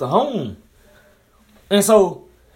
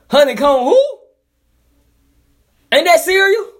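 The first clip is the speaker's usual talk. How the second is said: incredulous mock question; 13 semitones higher, 5 dB louder than usual. Real, not acted. Mocking a strange rap name.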